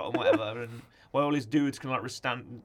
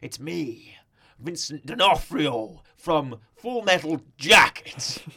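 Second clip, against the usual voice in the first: slow, loud, looming Kingpin voice; Kingpin impression; 7 dB louder, much slower than usual. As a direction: loom over her with a calm menacing growl. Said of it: "he's like".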